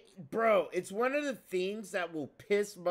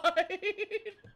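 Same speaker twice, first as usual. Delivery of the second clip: high burst of laughter; 13 semitones higher than usual. Real, not acted. Laughs after Gale quote.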